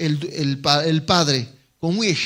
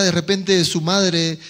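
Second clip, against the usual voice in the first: usual speaking voice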